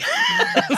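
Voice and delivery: real laughter bursting out; high burst of laughter. Real, not acted. Laughing at his own story, real laughter. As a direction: crack up at himself.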